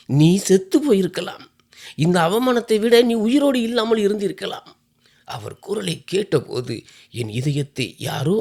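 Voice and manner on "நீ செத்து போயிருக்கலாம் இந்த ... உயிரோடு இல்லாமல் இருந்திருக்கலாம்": higher and louder, anguished outburst; trembling voice. Acted. Mother crying out at her daughter in shame, anguished and loud. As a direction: cry out in shame and despair. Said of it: "நடுங்கும் குரலில் சொன்னார்".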